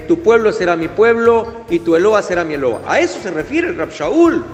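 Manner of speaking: ordinary talk, nothing marked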